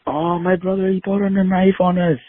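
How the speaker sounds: high, mock frightened kid voice; scared kids. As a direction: whine it like a scared little kid.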